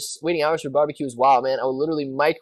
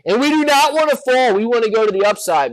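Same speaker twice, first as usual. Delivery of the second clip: urgent pleading; 7 semitones higher, 5 dB louder than usual. Real, not acted. Urging stock to hold.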